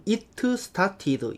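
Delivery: "it started" with an added Korean vowel, "i-teu seu-ta-ti-deu". Shown as a demonstration. Teacher mimicking extra-diligent readers, over-pronounced Korean-style English. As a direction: sound out every letter of "it started" as a separate Korean syllable. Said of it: "더 성실하신 분은".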